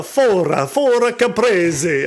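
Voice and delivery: loud, high, stretched-out fake Italian accent; mock Italian accent. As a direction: tease with a hammed-up Italian accent. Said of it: "say it in an Italian accent".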